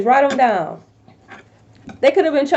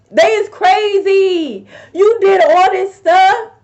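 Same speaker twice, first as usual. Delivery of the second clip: indignant; 6 semitones higher, 4 dB louder than usual. Real, not acted. Calling out returning ex.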